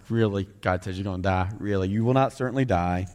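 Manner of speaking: snarky serpent. Retold or imitated.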